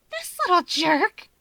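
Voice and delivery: put-on high bright girl voice; young woman voice. A narrator's reading. Rika's voice, high.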